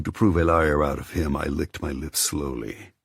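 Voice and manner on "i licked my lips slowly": normal talking voice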